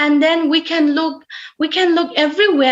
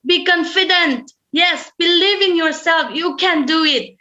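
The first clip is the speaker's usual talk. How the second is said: higher-pitched, encouraging pep talk; confident raised tone; 4 semitones higher than usual. Shown as a demonstration. Speaker showing an audience how to change tone, confident and raised. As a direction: lift your pitch and urge them to believe. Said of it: "that way we have to say, changing our tone".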